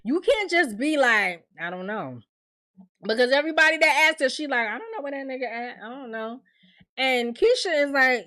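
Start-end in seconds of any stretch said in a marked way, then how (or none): 1.6-2.2 soft, imitated nonchalance; too calm. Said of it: "She a little too calm". 4.7-6.4 soft, quick mock brush-off; too calm. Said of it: "She a little too calm".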